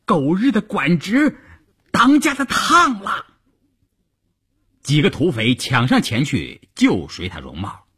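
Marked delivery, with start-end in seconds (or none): 0.0-3.2 high-pitched bandit yell; shouting. Acted. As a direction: call out the alarm to the gang, pitched high. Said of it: "听到这边有人喊". (高声喊叫)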